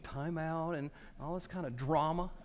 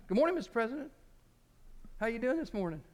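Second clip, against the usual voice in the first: higher, chirpy polite greeting; cheery staffer; 6 semitones higher, faster than usual. Pretend. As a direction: sing out a bright good morning. Staffer greets crazed president.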